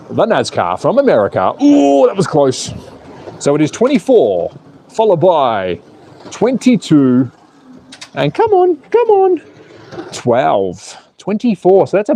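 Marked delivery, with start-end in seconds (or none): 1.6-2.7 high, slow gasp of relief; a drawn-out ooh at a near crash; close call. Real, not acted. Streamer watching his cars nearly collide, surprised. 8.1-9.4 very high, squealing come on; urging on. Real, not acted. Streamer cheering his car to the finish, excited.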